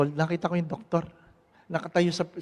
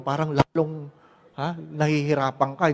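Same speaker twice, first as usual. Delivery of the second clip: ordinary talk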